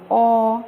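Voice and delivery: "ओ" said as an open "aw"; open O sound. Shown as a demonstration. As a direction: hold an open "aw" for "ओ". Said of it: "ये ओ की आवाज़ पढ़ो". ("ओ" को खुले ऑ जैसा बोलते हुए)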